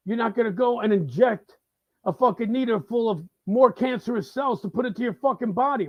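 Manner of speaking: ordinary delivery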